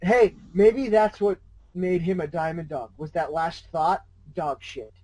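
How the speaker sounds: ordinary delivery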